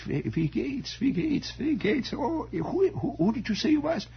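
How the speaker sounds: put-on high, befuddled old German husband; German accent. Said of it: "they all had this vague German accent".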